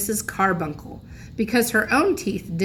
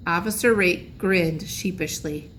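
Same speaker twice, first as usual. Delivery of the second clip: ordinary delivery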